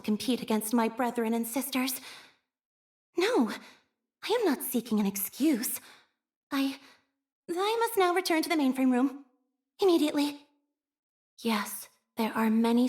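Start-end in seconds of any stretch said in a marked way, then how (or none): 3.2-10.4 higher, hurried denial; flustered. Acted. Embarrassed operator fleeing back to the mainframe room, flustered. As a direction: make an excuse and flee.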